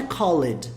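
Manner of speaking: "called" wrongly said in two syllables, "call-ed"; wrong pronunciation. Shown as a demonstration. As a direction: sound out the silent E in "called". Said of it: "a pronúncia não é called".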